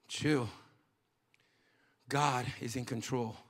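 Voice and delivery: low, soft reassurance; calm rebuff. Acted. Preacher answering a troubled spouse, calm and hushed. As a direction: drop right down and settle them.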